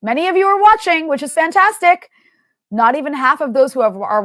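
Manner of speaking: half-joking shout to smash the like button; mock yell at the audience. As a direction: yell at the viewers with a grin. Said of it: "I need to yell at our audience".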